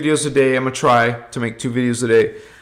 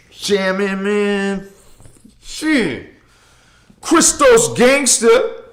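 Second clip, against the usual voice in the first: gangster voice; 5 semitones higher, slower than usual. Pretend. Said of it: "I was going a little [name] Gangsta on you".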